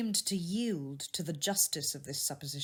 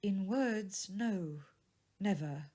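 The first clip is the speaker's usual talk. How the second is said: a lightly voiced young woman, a bit higher and sorrowful; lightly raised, grieving young woman; young woman's voice; 4 semitones higher, 5 dB louder than usual. A narrator's reading. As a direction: speak as the jilted sweetheart, hurt but composed. Belle voiced by narrator.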